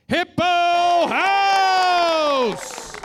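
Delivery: high, loud, hugely drawn-out name; announcer shout. Acted. Host bringing on next performer, long soaring shout. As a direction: stretch it out like a ring announcer.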